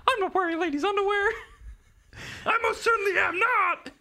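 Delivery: very high, loud puppet voice; Pinocchio voice. Retold or imitated. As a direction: squeak it out like a wooden boy, indignant. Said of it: "I can do Pinocchio".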